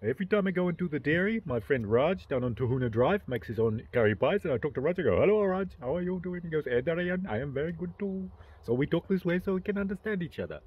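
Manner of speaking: singsong mock Indian accent. Shown as a demonstration. Chatting with Raj at the dairy, mock Indian accent. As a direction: tell it all in a put-on Indian accent. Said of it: "So I speak Indian".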